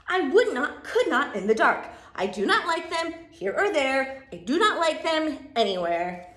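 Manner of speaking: loud, punchy, falling phrases; emphatic storybook refusal. Acted. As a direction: refuse firmly, hammering every phrase. Reads the refusing character's part.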